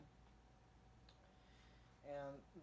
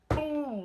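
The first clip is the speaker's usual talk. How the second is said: much higher and much louder shouted boom; explosion sound; 12 semitones higher, 14 dB louder than usual. Retold or imitated. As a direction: blast it out like an explosion. Imitating the bomb drop.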